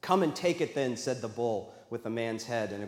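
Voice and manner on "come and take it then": ordinary delivery, nothing marked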